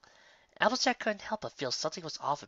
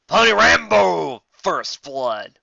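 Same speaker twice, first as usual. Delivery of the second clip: slow, loud, dramatic trailer announcer; movie trailer voice; 4 semitones higher, 11 dB louder, much slower than usual. Acted. Narrator announcing an action film, slow booming trailer voice.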